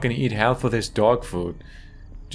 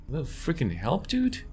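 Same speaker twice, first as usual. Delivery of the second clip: sarcastic plea; 6 semitones higher, 4 dB quieter than usual. Real, not acted. Annoyed asking for help.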